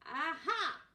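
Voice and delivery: high, loud gotcha cry; triumphant aha. Acted. Mister Large catches his wife with the knife, loud and triumphant. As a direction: pounce on the moment. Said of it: "said Mister Large".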